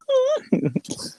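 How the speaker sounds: high, drawn-out fake moan; mock moaning. Friend re-enacting a prank video's audio, mock moaning.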